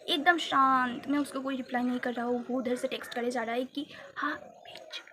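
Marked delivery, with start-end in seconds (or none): 4.2-5.0 whispered insult. Retold or imitated. Boy's threatening text voiced.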